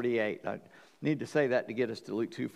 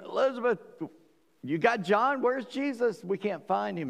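higher, urgent questions to a relative; anxious asking around; 6 semitones higher than usual. Retold or imitated. Mary asks Elizabeth where Jesus is, higher urgent worry. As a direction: ask around like a worried mother.

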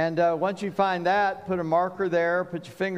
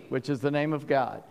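ordinary delivery

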